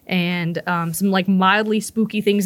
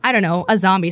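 usual speaking voice

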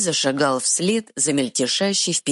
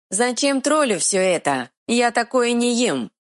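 stubborn dim-witted troll; 10 semitones higher than usual. Acted. Troll speaks.

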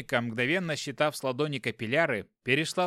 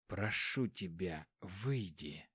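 very quiet, low, slow dying plea; last breath plea; 6 semitones lower, 10 dB quieter, much slower than usual. Acted. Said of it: "на последнем издыхании взмолился Эмлин". (на последнем издыхании)

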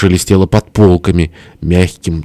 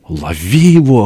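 raised, hoarse command; hoarse cry; 4 semitones higher than usual. A narrator's reading. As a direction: rasp out an order to grab him. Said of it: "хрипел тесть". (хриплый приказ)

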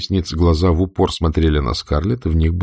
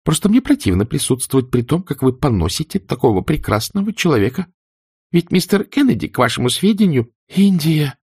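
indignant; 9 semitones higher than usual. A narrator's reading. India quarrelling, Melanie interrupts.

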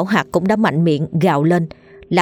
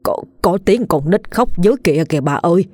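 put-on old man points at the grave and panics, telling his wife to run; scared stutter into an urgent run call; frightened stammer. A narrator's reading. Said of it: "lắp bắp sợ hãi".

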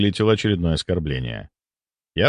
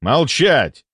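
higher-pitched, curt command; raised voice; 4 semitones higher, 3 dB louder than usual. A narrator's reading. Doctor silences the indignant deputy, voice raised. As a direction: order silence with a lifted voice. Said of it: "Я повысил голос".

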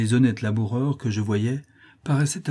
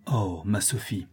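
plain reading voice

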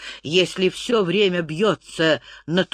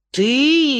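sneering; 8 semitones higher, 5 dB louder than usual. A narrator's reading. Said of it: "ехидно усмехнулся".

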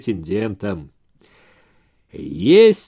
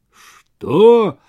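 high, loud outraged shout; exploding outburst; 8 semitones higher, 7 dB louder than usual. A narrator's reading. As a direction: blurt it out in disbelief. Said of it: "мгновенно взорвался".

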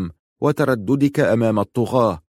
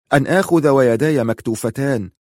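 plain reading voice

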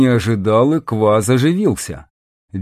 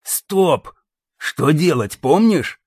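barked; 4 semitones higher, slower than usual. A narrator's reading. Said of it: "моментально протрезвев рявкнул".

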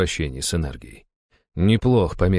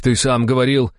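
high, insistent raised voice; barked reproach; 10 semitones higher, 4 dB louder than usual. A narrator's reading. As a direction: throw his own words back at him. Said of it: "рявкнул на него другой дружинник". (резко упрекнуть)

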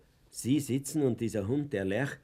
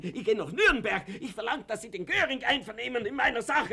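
defiant threat; 9 semitones higher, 4 dB louder than usual. Acted.

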